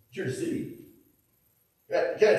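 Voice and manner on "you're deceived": breathy whisper of a verdict. Acted. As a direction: drop to a whisper to deliver the point. Punchline after laugh, unvoiced.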